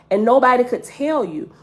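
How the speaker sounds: usual speaking voice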